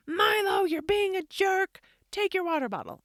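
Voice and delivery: high, loud mock parental yell. Mom showing the yelling she avoided, loud scolding. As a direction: act out blowing up at a kid. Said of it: "better than just yelling at him".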